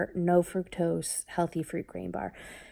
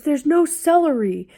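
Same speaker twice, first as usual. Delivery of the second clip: put-on high, louder whiny kid voice; complaining kid; 8 semitones higher, 9 dB louder than usual. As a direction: play the upset son.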